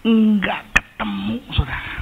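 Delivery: high, breathy whisper on the edge of tears; a choked, near-crying whisper; tearful whisper. Retold or imitated. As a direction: whisper it as if about to cry. Crying search for lost motorbike.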